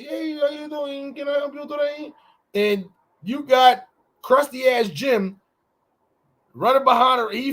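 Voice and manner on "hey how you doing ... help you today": chirpy customer service greeting; cashier voice. Retold or imitated.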